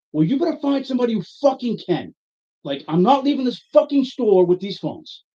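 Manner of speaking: high-pitched irate outburst; over-the-top anger. Retold or imitated. Angry customer refusing to leave the store, over the top. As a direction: push the pitch up and lay into the clerk. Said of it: "I am over the top".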